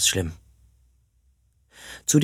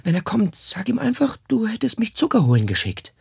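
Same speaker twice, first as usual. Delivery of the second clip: flehendes Kind; 8 semitones higher than usual. Acted. Kind fleht Mutter um Schutz an.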